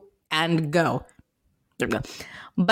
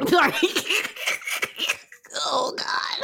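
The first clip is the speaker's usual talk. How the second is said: coughing after a shot went down wrong; sputtering and coughing, real; choking on a drink; 9 semitones higher than usual. Real, not acted. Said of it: "It went down the wrong pipe".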